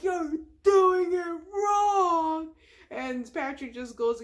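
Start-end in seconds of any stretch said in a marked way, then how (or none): 0.0-2.5 high, loud, very slow wail; frantic cry. Retold or imitated. SpongeBob wrestling with the door, wailing at Patrick. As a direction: cry it out in a panic. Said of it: "He's like".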